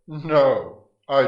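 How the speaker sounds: sudden loud, high No; defiant shout. Acted. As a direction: fling the refusal at him. Death defies Apollo.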